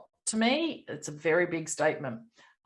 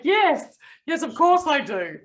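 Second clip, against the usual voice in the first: playfully echoing her yes, much higher and louder; mock emphatic yes; 8 semitones higher, 6 dB louder, slower than usual. Host teasingly imitating the guest's emphatic yes. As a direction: ham up the guest's eager yes. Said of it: "I love that emphatic".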